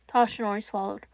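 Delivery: ordinary delivery, nothing marked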